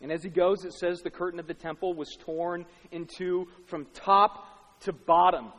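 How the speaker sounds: usual speaking voice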